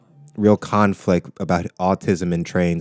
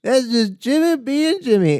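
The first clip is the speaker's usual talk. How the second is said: higher, slower put-on repeat; mock comedian voice. Repeating a comic's catchphrase high and slow. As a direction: drag the catchphrase out. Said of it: "he did this thing where he was like".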